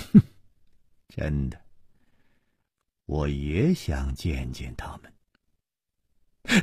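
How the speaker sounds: low, quiet, slow scorn; a short scornful huff, then soft, drawn-out words; cold sneer. A narrator's reading. Chu Liuxiang doubting Liang Ma's story, sneering coldly. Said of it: "楚留香冷笑着说".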